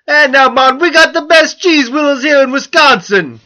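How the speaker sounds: put-on loud, high-pitched botched Jamaican accent; hammed-up, terrible Jamaican accent; bad Jamaican accent. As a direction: attempt Wisconsin, land in a shouted Jamaican accent. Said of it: "comes out as a Jamaican accent".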